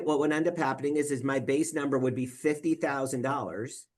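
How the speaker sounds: ordinary talk, nothing marked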